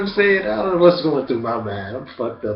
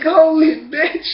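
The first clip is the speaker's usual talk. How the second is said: high, loud, slow stunned cry; stunned exclamation; 7 dB louder, slower than usual. Retold or imitated. After being slapped hard, a high stunned cry. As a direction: reel from the slap and cry it out. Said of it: "I'm like".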